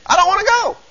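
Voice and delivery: high-pitched, hammed-up whine; whiny refusal. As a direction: whine like a kid digging in his heels. Acts out a reluctant heathen refusing.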